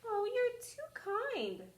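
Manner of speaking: put-on girlish and grateful, voice lifted high; high, slow, sweet girl. Girl thanks the wolf for his kindness, sweetly. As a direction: sound charmed and innocent.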